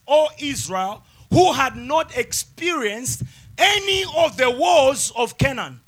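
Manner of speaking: slow, emphatic Bible reading with stretched phrases; preached scripture reading. Real, not acted. Preacher reads Judges chapter three aloud, emphatic preaching. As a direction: read it out as a proclamation to a congregation.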